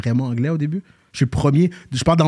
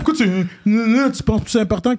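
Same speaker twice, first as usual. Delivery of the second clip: high-pitched imitation of teasing kids; mocking schoolmates; 8 semitones higher, faster than usual. Imitating people who teased him about his accent, high mocking voice. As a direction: mimic them picking on how you talk. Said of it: "fallait que je change comment je parle parce que j'étais tanné que le monde disait".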